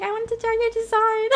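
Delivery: a hint of a sweet new character, fast. Streamer quoting the artist's new character, lightly voiced and quick. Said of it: "she's doing a new character here".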